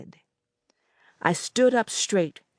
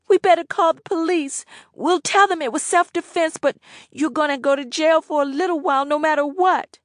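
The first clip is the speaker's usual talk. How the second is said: much higher, louder, fast; anxious girl; 10 semitones higher, 5 dB louder than usual. A narrator's reading. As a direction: rush the plan out nervously. Annette's voice.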